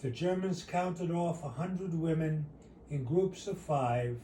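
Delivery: ordinary delivery, nothing marked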